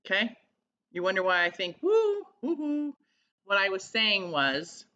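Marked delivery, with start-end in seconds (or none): 1.8-2.9 drawn-out, high-pitched hooting woo; high woo-hoo. Acted. Speaker showing why it seems strange, high woo-hoo. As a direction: let out long, high woos. Imitates an odd inner reaction.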